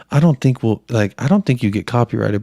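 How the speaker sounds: ordinary delivery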